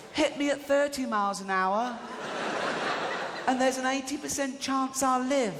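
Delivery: high-pitched imitation of a child; child in advert. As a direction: put on a high little-kid voice. Imitates child in road-safety advert.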